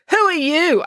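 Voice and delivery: high, loud, blunt demand; rude. A narrator's reading. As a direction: bark it at the stranger. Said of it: "asked April rudely".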